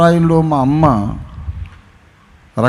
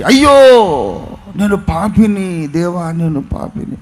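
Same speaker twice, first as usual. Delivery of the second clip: retold wail of a sinner, pitched up; loud cry; 5 semitones higher, 5 dB louder than usual. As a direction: cry out to God in sudden remorse. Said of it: "అతను బిగ్గరగా కేక పెట్టి".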